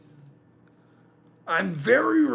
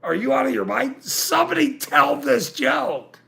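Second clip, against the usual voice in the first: retold exasperation; 7 semitones higher than usual. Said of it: "And I went".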